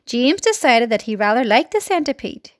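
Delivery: usual speaking voice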